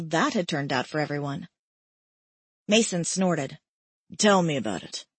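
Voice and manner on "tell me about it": low, dry male reply; wry man's voice, pitched down; lower male voice. A narrator's reading. Male character voiced by narrator.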